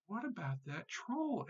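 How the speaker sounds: small high, soft goat voice; tiny billy goat. A narrator's reading. Tiny goat asks his brothers about the troll, little high voice. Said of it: "asked the tiny billy goat Gruff".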